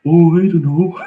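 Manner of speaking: put-on high, loud, sing-song Wisconsin accent. Irishman imitating the Ed Gein documentary, hammed-up Wisconsin accent. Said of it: "the Wisconsin accent is brilliant".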